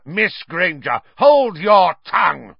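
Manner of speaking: high, loud, slow-hammered rebuke; angry shout. A narrator's reading. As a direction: silence the pupil with a shout. Snape cuts Hermione off.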